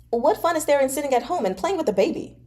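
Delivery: lightly voiced pushy friend; eager young noble. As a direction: talk him into coming along. Fei Shi teasing.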